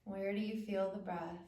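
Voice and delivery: calm, spacious phrases with long pauses; unhurried body-awareness meditation voice; guided meditation. Acted. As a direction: guide attention gently through the body.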